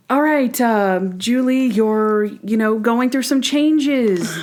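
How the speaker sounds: stilted, drawn-out fatherly lecture; hammed-up talk. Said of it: "he is hamming it up".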